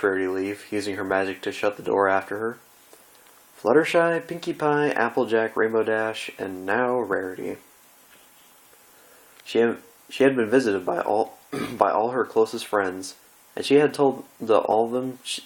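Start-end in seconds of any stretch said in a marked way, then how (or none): none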